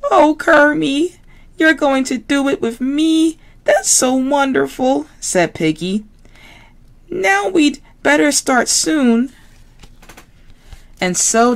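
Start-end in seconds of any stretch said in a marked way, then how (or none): 0.1-5.1 very high squealy piggy voice; Miss Piggy voice. Acted. Miss Piggy to classmates, very high pig voice. 7.1-9.3 very high squealy piggy voice; Miss Piggy voice. Acted. Miss Piggy to classmates, very high pig voice.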